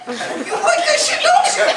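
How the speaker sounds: high, slow hammed-up bedroom voice; silly falsetto dirty talk; mock sexy talk. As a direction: ham up a squeaky seductive taunt. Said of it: "you be like".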